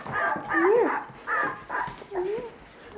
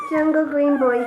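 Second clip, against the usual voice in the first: ordinary delivery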